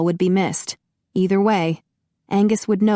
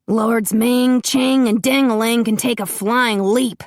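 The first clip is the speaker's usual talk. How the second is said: louder, higher scornful snarl; growling, defiant; 5 semitones higher, 3 dB louder than usual. A narrator's reading. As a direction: spit the insult at them. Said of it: "Russell growled".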